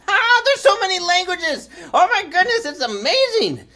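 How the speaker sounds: high-pitched, gushing wonder; excited amazement. Real, not acted. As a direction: marvel out loud, thrilled. Marvels at the many languages.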